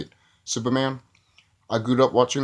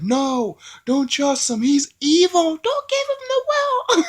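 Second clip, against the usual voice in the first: much higher, pleading cry of alarm; mock warning; 3 dB louder, slower than usual. Onlookers warning the old woman about Lex, high mock panic. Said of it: "everyone is like".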